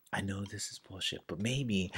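tempted doubter. Retold or imitated. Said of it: "people are just like".

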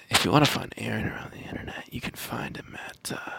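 fast creepy whisper. Pretend. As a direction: hush it like a secret. His creepy outro shtick again.

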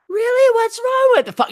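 high incredulous mock girlfriend; high falsetto mock surprise. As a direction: squeak it out in disbelief as her.

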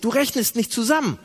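usual speaking voice

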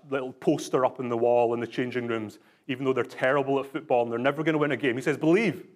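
usual speaking voice

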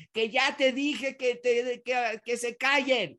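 hammed-up exasperated shout; mock yell at kids. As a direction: act out a parent losing it with the kids. Parent yelling at kids, acted.

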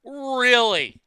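loud, drawn-out disbelieving really; incredulous. Real, not acted. Reacting to fake boobs news, loud incredulous really. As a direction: let disbelief ring out.

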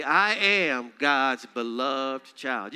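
slow, deliberate confession, word by word; slow affirmation. Shown as a demonstration. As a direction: let each word land slowly.

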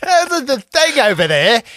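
high, plummy British put-on; mock proper British accent. Joking about British UFO witnesses, high mock British accent. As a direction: point at the sky like a posh Brit. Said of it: "the British accent as being, like, so proper".

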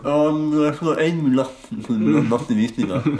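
mock mouth full of popcorn. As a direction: imitate her chewing popcorn. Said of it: "hun spyttet jo nesten popcorn ut". (munnen full av popcorn, hermet)

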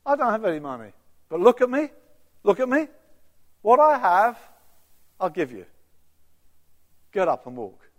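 loud ringing command, pitch rising; commanding Peter. Acted. Peter orders the beggar to get up, loud and commanding.

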